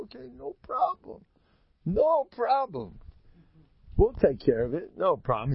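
a lightly sly, easygoing okay; mock agreeable. [name] pretending to accept the ruling, lightly sly. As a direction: play along, slyly.

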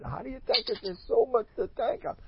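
high, very soft quoted voice; small, high, reverent voice; hushed wonder. Retold or imitated. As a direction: quote it softly and high, in awe. Quotes a Rebbe marvelling at gratitude.

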